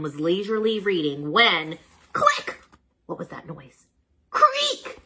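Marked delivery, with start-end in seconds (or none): 2.1-2.6 sharp high click sound; sound effect. A narrator's reading. Narrator voices a sudden click at the door. 4.3-5.0 high creaking voice; creaky sound effect. A narrator's reading. Narrator voices the door creaking open.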